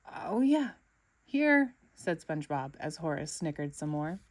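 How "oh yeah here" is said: higher and louder flustered reply; caught-off-guard answer; startled. Acted. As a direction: blurt it out flustered.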